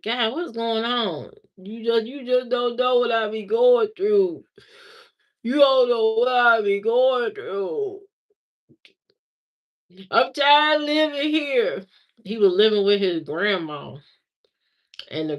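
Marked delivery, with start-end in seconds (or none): 0.0-11.9 mock the sulking boyfriend; mopey whining fan. Ex whining to her about his troubles, mock sulky whine.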